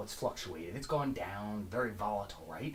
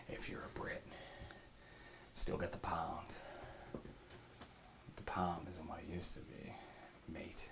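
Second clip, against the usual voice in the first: hushed whisper; 3 semitones lower, 12 dB quieter than usual. Acted. Hushed aside to Brits.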